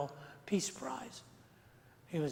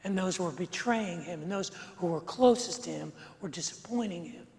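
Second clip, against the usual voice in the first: normal talking voice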